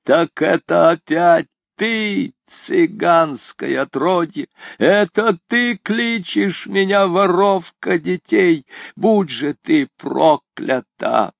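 high, slow, rasping curse; death rattle. A narrator's reading. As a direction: curse her in a dying rasp. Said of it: "голосом, походившим на предсмертное хрипение".

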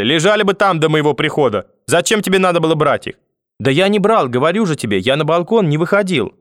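high-pitched, heated quarrel voices; grandmother and boy arguing, indignant. A narrator's reading. Grandmother grilling grandson about the slippers, indignant quarrel.

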